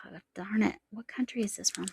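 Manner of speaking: real whispered muttering over tiny print; whispered to herself. Real, not acted. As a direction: whisper it, frustrated, to yourself.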